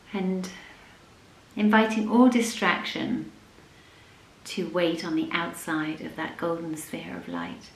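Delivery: soft, unhurried yoga nidra voice with long pauses; guided meditation. Acted. Leads a yoga nidra practice.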